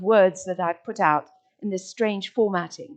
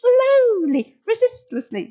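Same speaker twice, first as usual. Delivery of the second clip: as a dance; 5 dB louder than usual. Shown as a demonstration. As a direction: swoop up high and dance the words. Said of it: "say it as a dance".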